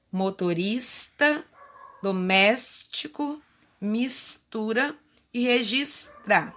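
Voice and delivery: words read slowly, each S made clear; slow careful reading. Shown as a demonstration. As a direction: read the list slowly so the S stands out. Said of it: "Agora vamos responder".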